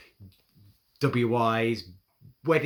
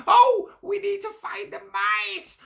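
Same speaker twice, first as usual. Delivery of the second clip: very high, slow cat voice; frantic cats deciding; panicking cats; 7 dB louder, slower than usual. Acted.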